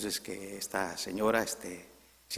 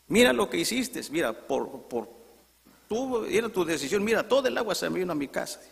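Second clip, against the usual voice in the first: high, heated acted scolding; neighbour shouting; 9 semitones higher, 3 dB louder than usual. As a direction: berate the neighbour in a raised, sputtering voice. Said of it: "y viene gritando". (regaño airado y agudo de vecino)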